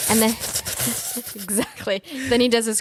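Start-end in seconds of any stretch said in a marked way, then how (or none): 0.4-1.3 creepy sucking hiss in imitation; quick voiceless mock hiss. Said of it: "hissing thing".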